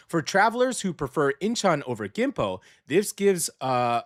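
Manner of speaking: normal talking voice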